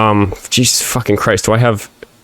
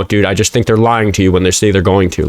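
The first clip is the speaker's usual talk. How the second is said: ordinary talk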